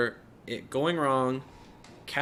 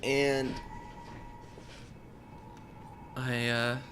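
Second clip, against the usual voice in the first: a touch of real sorrow, softer as it goes; choked up; 3 dB quieter than usual. Real, not acted. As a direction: apologize gently to someone you are about to lose. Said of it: "I'm genuinely getting choked up here".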